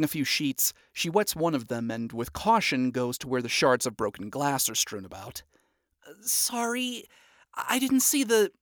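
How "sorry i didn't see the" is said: put-on higher apologetic girl voice; lightly voiced nervous young woman; sheepish apology.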